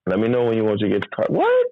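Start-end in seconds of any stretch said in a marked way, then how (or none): 1.3-1.7 high cracking "what?", half laughing; disbelieving shout. Retold or imitated. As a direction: shriek it in mock disbelief. Incredulous outburst.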